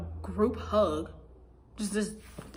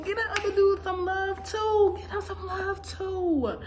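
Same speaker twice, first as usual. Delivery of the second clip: exasperated high pitch; 10 semitones higher, 3 dB louder than usual. Real, not acted. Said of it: "indignant rant about other dudes".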